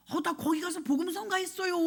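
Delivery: high, mock-defensive student voice; protesting student. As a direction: imitate a student making excuses, pitched well up. Student's retold excuse. (변명하는 학생 흉내)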